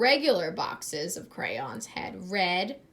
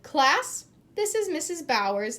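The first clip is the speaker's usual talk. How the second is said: higher, louder teacher intro; teacher announcement; 7 semitones higher, 5 dB louder than usual. A narrator's reading. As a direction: present the guest to class.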